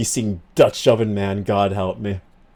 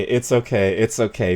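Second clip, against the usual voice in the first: ordinary talk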